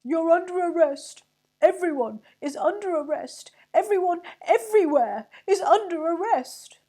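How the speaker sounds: high, very loud raging yell; furious shout. A narrator's reading. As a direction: scream it hopping with rage. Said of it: "hop about with rage and shout".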